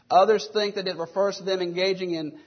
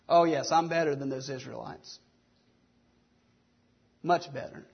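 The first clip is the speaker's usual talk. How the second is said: quieter, self-satisfied mock boast, fading low; smug pious boast; 6 semitones lower, 5 dB quieter than usual. Preacher mocking the congregation's hidden pride, smug and quiet. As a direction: sound quietly pleased with yourself, tongue in cheek. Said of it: "none of us would ever say that out loud".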